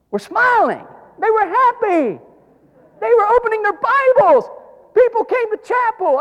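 high and loud, rising with joy; excited preaching. Real, not acted. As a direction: lift the crowd with wonder.

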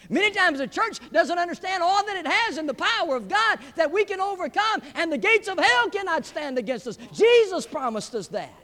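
high, loud, surging pulpit cry; full-force preaching. Real, not acted. Preacher declaring the church's power over hell, full-force preaching. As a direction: thunder the promise to the congregation.

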